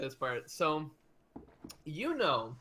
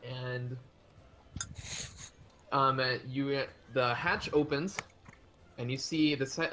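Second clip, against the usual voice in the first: usual speaking voice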